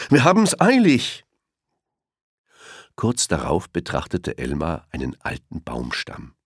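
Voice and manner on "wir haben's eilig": much higher, louder rushed brush-off; hurried elephant. A narrator's reading. Elephant rushes past Elmar, high hurried brush-off. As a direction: wave him off in a hurry. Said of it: "sagte einer der Elefanten".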